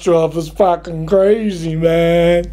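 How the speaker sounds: dazed, sheepish druggie voice with laughs; sheepish stoner. Retold or imitated. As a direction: play the stoned passenger pleading. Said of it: "with this sheepish look on his face going".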